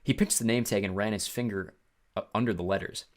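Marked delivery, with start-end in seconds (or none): none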